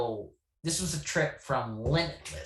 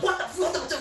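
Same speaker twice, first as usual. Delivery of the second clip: startled confusion; 4 dB louder, much faster than usual. Retold or imitated. Friends startled, retold.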